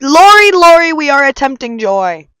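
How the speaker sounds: high, loud call; loud excited call. Real, not acted. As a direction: shout across to a friend. Calling out to friend Lori.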